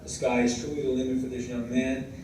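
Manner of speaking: ordinary talk, nothing marked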